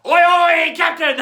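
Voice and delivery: loud, high shouted chant; full-throated pirate cheer; rousing shout. Shown as a demonstration. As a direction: bellow it out for the crew. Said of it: "let me hear it now".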